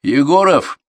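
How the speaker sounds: loud, high summons; instructor's shouted call; sudden roll call. A narrator's reading. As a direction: call the pupil out sharply. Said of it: "вдруг вызвал он".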